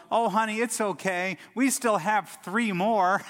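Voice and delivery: hammed-up reassurance, slowing at the end; cheery wife. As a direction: play the wife brightly consoling her husband. Wife voiced soothing her husband.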